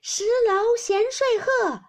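high, loud, drawn-out recited shout; shouted verse. A narrator's reading. Xiangyun breathless with fun in a verse contest, shouting her line. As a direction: call the line out slowly at full voice. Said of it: "湘云喊道".

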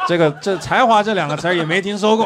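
loud, giggling through the words; laughing. Real, not acted. Laughing at a friend's punchline about talent. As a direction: laugh it out loud as you answer.

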